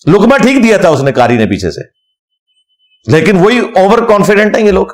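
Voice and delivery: ordinary delivery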